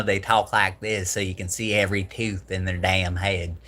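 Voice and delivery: toothy, exaggerated Alabama twang; Alabama accent. Shown as a demonstration. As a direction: imitate an Alabaman showing every tooth. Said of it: "People from Alabama".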